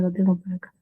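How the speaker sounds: ordinary talk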